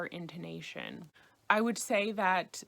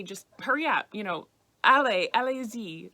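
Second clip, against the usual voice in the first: urging stranger; 9 dB louder than usual. Retold or imitated. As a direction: hurry someone along through the gate. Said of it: "to encourage me".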